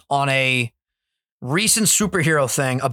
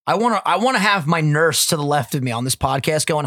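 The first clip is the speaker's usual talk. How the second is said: ordinary delivery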